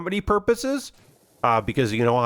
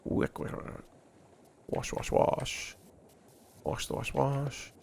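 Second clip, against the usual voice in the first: whisper; 12 dB quieter than usual. Real, not acted. Chanting while power washing.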